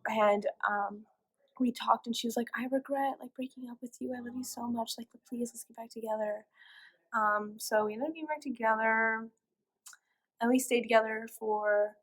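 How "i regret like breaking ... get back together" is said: soft, retold plea; imitated pleading girlfriend. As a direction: imitate her asking meekly to reunite.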